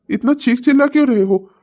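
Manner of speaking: ordinary talk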